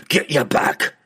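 gruff dwarf king's roar; commanding roar. A narrator's reading. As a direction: roar him back with sheer force. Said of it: "Bruner roared at the battle ranger".

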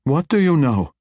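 a drow matriarch pressing the mage; Matron Mother's voice. A narrator's reading. Matriarch questioning the mage, intense and high.

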